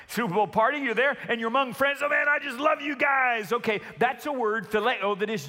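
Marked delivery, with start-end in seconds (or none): 2.0-3.5 buddy enthusiasm. Retold or imitated. Pastor imitating a fan at a Super Bowl party, excited shout. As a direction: cheer it like a fan among friends.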